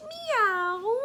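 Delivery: high, rising meow; high playful rising meow; cat meow. Shown as a demonstration. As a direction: mew like a kitty. Cat sound for child.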